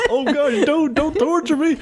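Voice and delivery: high squealing laughter; laughing hard. Real, not acted. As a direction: crack up while pleading. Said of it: "Shut up, dude".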